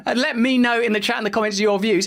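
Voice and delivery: ordinary talk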